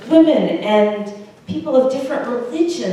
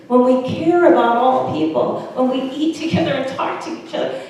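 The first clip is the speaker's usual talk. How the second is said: usual speaking voice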